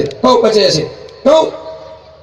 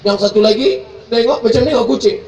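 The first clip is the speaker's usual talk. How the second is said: ordinary talk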